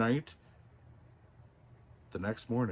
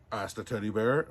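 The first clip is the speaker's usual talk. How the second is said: ordinary delivery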